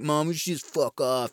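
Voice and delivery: lightly voiced sullen teen attitude; mock the daughter telling mom off. Reviewer voices the daughter to her mom, mock attitude. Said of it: "She's got the attitude".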